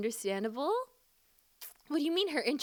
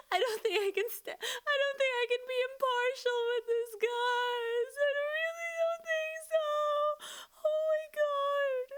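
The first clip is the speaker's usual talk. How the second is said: much higher, squeaky whining; flustered squeal. Real, not acted. Flustered by the character, high whine. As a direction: whine it out helplessly.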